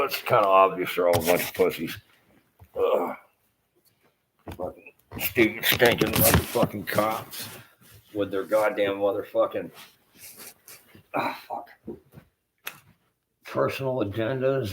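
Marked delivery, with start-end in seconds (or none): 2.7-14.7 raging at the local cops; angry rant. Real, not acted. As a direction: spit out every curse at the cops. Ranting at the county cops.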